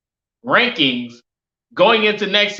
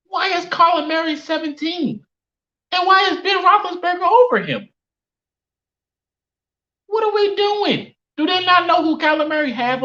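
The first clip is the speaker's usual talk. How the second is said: incredulous; 9 semitones higher than usual. Real, not acted. Outraged reaction to rankings list.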